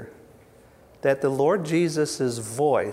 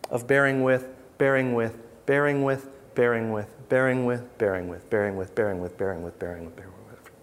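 fading repetition; 3 semitones lower than usual. Real, not acted. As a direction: repeat it, fading to nothing. Repeating bearing with, fading out.

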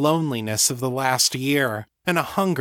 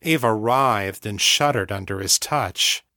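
usual speaking voice

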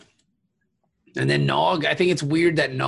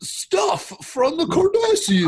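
mocking crewman; 5 semitones higher, 3 dB louder than usual. Mocking doomed crewman's bragging.

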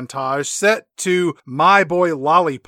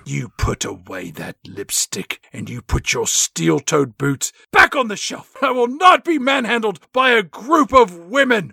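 put-on huffy manager voice; mock stern boss. [name] to the Spice Girls, mock stern huff. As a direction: scold like a pompous boss.

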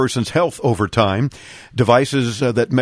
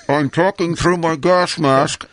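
higher, slow, muffled as if through a gas mask; 4 semitones higher, slower than usual. Retold or imitated. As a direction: talk like your face is behind a respirator. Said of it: "It's pretty much".

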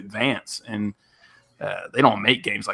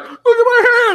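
very high, loud comic wail; mock wailing; 6 dB louder than usual. Imagined Larry David after the hospital handshake, high mock wail. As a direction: wail it in exaggerated agony.

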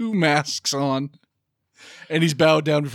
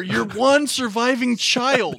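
mock demand; 8 semitones higher than usual. Podcaster challenging the wife about her child, high mock outrage. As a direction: hammer each word in hammed-up disbelief.

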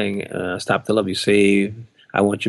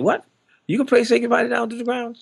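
very high-pitched incredulous question; mock disbelief; 13 semitones higher than usual. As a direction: imitate a bandmate taken aback, pitch way up. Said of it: "he sat up and said".

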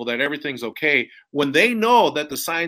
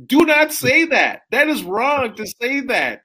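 higher, emphatic protest; heated objection; 10 semitones higher, 4 dB louder than usual. Retold or imitated. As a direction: firmly object in front of a crowd. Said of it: "I got up and I said".

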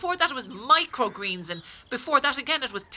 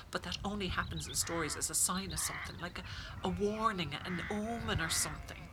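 hushed superstition; 4 semitones lower, 10 dB quieter than usual. Acted.

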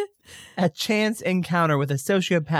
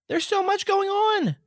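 high, amazed exclaim; overwhelmed exclaiming. Retold or imitated. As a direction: play up being blown away. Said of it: "and I said".

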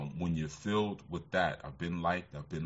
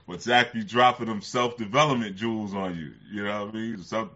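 usual speaking voice

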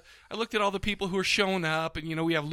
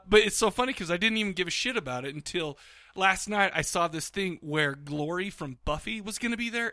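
ordinary talk, nothing marked

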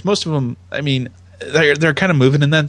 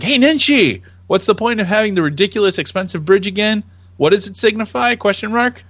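higher-pitched voice for an irked tweeter; a hint of indignant heckler; mock complainer; 7 semitones higher than usual. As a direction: lightly act out a griping tweet.

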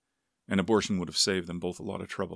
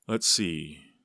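gruff father; 4 dB louder than usual. A narrator's reading. Gruff father reckons up the pay.

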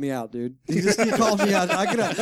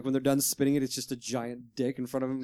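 ordinary delivery

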